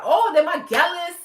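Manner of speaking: high, loud mock boast of a Jamaican man; imitating a cocky man bragging about his women. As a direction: ham up a proud bragging Jamaican guy. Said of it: "they be like".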